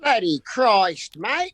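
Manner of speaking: slow, broad Aussie exclamation, rising on mate; Australian accent. Pretend. Flea answers the host in a hammed-up Australian accent. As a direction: stretch it out in broad Australian, lifting the end.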